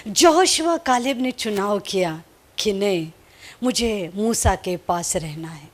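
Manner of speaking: usual speaking voice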